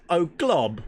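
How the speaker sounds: "Oh, glob." in a high, loud mock British accent. Shown as a demonstration. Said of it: "read it in a British accent".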